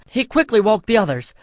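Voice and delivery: plain reading voice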